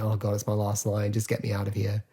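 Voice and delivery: an actor at the end of a long day, drained and fed up; weary, done. Retold or imitated. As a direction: sigh it out like it's been a long day. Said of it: "he just wants to go home".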